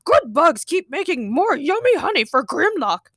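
high-pitched, greedy robot dinosaur voice; put-on monster voice, delighted; Grimlock. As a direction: gloat like a simple-minded robot dinosaur. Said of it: "the robotic T-Rex shouted".

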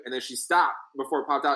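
usual speaking voice

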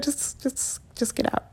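breathless whisper. Retold or imitated. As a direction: whisper it, barely voiced. Retold telling him leave.

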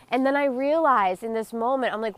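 ordinary talk

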